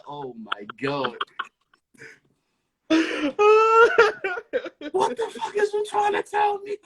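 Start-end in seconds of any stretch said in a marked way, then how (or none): 2.9-6.8 high cackling disbelief; laugh-scream the question; shrieking laugh. Retold or imitated. Mom laugh-screaming at her son over the onesie. Mom's shocked laughing yell.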